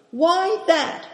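high, cheeky challenge; high-pitched impudent query; insolent question. A narrator's reading. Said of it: "asked Ma insolently".

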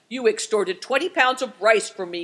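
loud, mildly growling grievance; growled complaint. A narrator's reading. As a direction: grumble it forcefully at them. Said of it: "growled the man".

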